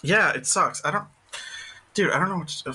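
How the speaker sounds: ordinary talk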